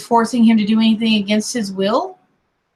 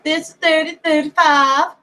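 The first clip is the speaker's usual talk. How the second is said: higher-pitched Southern radio call; Southern accent; 6 semitones higher than usual. Retold or imitated. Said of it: "I used my sweet Southern accent".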